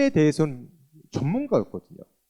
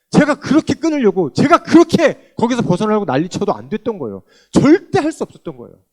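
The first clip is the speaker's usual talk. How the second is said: loud, higher, fervent testimony; preaching; 5 semitones higher, 11 dB louder than usual. Acted. Testifying to the congregation, fervent loud preaching. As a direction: proclaim it with full force.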